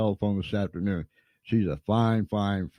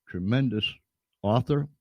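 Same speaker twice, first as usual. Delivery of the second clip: ordinary talk, nothing marked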